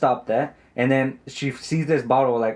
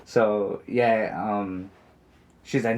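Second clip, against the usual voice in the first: usual speaking voice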